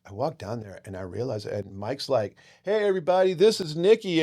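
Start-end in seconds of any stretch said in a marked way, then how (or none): 2.6-4.2 imitating a raised-voice introduction to a room; calling out introduction. Singer presenting his friend to the VIP room, acted calling out. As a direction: announce a friend, higher and louder.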